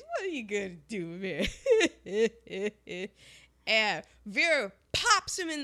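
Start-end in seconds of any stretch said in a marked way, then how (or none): 0.0-1.5 quick, lightly imitated taunt; quick mock challenge; Eddie Murphy impression voice. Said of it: "Eddie Murphy's doing his". 1.5-3.1 imitated signature chuckle; put-on laugh in bursts; Eddie Murphy laugh. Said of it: "doing his Eddie Murphy laugh".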